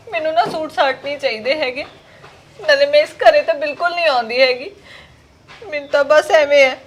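ordinary talk, nothing marked

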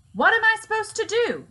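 high, loud desperate shout. A narrator's reading. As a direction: yell it out in panic with the gun in hand. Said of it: "Barry shouted".

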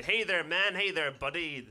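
mock a loud lad sidling up; brash passer-by. Lads accosting him about his kilt, brash mocking greeting. Drunken men approaching him.

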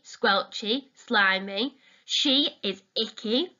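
plain reading voice

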